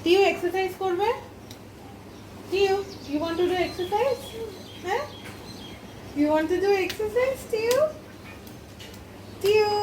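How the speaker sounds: high, bright motherly voice; talking to a toddler. Acted. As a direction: coax and call the kids. Mother calls and coaxes her kids.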